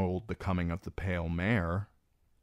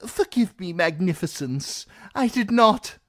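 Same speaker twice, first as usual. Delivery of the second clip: high, louder frightened apology; cowering plea; 12 semitones higher, 8 dB louder than usual. Acted. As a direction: grovel quickly. Reznak cowers.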